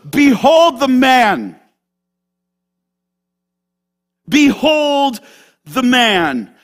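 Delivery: loud, slow proclamation; Pilate's proclamation. Acted. Pilate presenting Jesus to the mob, loud and slow. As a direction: announce it to the mob, slowly. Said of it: "He goes".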